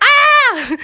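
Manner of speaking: high, loud cry of joy; joyful cry. Retold or imitated. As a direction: let out an elated yelp. Joyful exclamation at sky.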